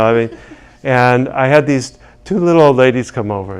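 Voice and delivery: ordinary talk, nothing marked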